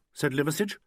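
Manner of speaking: ordinary delivery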